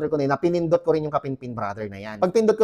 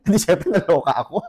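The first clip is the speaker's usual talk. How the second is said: laughing; 3 semitones higher than usual. Real, not acted. Recounting shock, laughing.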